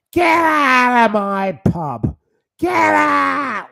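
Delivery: high, drawn-out shout from a landlady; Peggy Mitchell. Acted. Ranting host mimics a pub landlady throwing someone out, shrieked. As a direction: scream it loud and high, stretching the words. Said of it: "It's like Peggy Mitchell".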